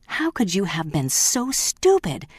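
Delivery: slow, high, furious; an angry master scolding his servant; angry yell. Acted. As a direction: tear into the housekeeper. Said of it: "he yelled".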